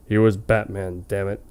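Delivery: ordinary talk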